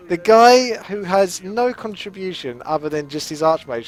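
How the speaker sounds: high, loud, drawn-out indignant rant; mock outrage. Gamer protesting that a teammate stole the item, theatrical outrage. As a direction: start at a shrieked peak, stay hammed-up and incredulous.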